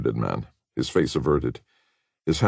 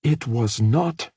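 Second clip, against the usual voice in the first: higher, slow, emphatic denial; flashing back; 9 semitones higher, slower than usual. A narrator's reading. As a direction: Katie retorting sharply to Rick. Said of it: "Katie flashed back".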